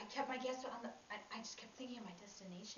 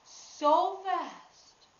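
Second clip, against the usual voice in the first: very loud, high burst; explosive, high-pitched emphasis; sudden urgency; 9 semitones higher, 23 dB louder than usual. Retold or imitated. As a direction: belt it out with a rush of urgency. Acts out flooring the pedal.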